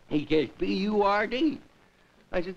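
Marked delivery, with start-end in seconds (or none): 0.6-1.6 put-on stubborn kid repeating B U R D, pitch swooping up; insistent spelling. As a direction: spell out B U R D again with a rising, sure-of-it end. Boy repeats his wrong spelling.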